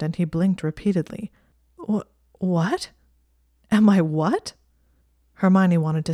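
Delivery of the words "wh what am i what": stunned disbelief. Acted. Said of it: "too stunned to even react".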